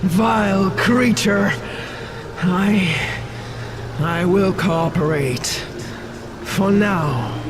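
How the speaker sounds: halting, bitter submission; bitter surrender. Acted. Queen yielding to save her children, bitter reluctance. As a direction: give in haltingly, with contempt underneath.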